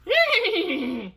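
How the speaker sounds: high, descending whinny; whinny like a horse; horse neigh. Acted.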